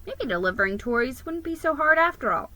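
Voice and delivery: usual speaking voice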